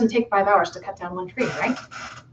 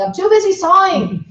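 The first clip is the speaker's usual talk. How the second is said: higher, stressing "busy" with a falling end; emphatic; 9 semitones higher than usual. A narrator's reading. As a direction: insist hard on "busy". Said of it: "the man says emphatically".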